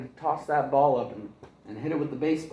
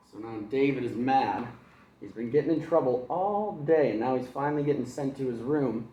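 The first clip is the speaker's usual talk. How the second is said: ordinary delivery, nothing marked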